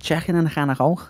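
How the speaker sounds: put-on a quick, high Scouse-accent phrase. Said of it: "rendition of a Scouse accent".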